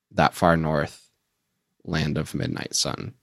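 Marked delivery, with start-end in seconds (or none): 1.8-3.1 softer, lower, deadpan mock title; dismissive repeat. Shown as a demonstration. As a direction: drop it like a lame label. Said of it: "which I think is kind of a lame nickname".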